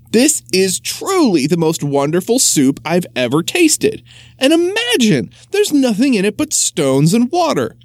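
high, marvelling praise; delighted mayor. Acted. Mayor tasting the soup, delighted and rising high. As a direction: savour it with wonder. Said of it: "said the mayor with delight".

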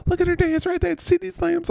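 very high falsetto excitement; playful falsetto cheer; squeaky cheering. Pretend. Cheering the Lamb dance bit.

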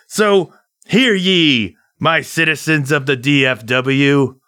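put-on high, slow old-fashioned decree; mock proclamation. [name] declaims to the citizens of DFW, high slow proclamation. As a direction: declaim like a town crier.

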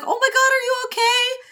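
high frantic flustered apology; apologizing too much. Retold or imitated. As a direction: gush sorry in a squeaky panic. Wei Wuxian fussing over chin.

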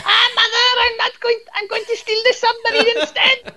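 put-on very high, loud Welsh boy whining back; hammed-up Welsh cartoon falsetto, protesting to mother; bad Welsh accent. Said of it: "I can't do a Welsh accent".

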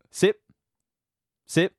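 ordinary delivery

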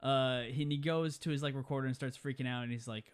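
ordinary talk

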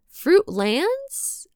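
Fruitlands? asked with a high rising doubtful tune; questioning rise. Shown as a demonstration. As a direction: say Fruitlands? like checking your own pronunciation. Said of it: "is my Midwestern accent mangling this".

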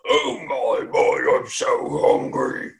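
slow, hammed-up giant voice calling out; a drawn-out giant's yell; hungry giant. Giant singing out into the darkness that it's hungry, a slow yell. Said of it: "you hear it yell out like that".

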